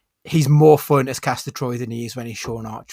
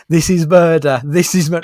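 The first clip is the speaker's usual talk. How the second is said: raised voice breaking into laughter; half-hearted imitation scream, louder than chat; mock scream; 3 semitones higher, 7 dB louder than usual. Retelling a film death scene to co-hosts, laughing mock scream. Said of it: "as he's screaming".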